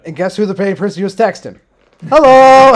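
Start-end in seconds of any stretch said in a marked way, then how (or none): 2.1-2.7 loud shout. Real, not acted. Mocking reveal of who he texted.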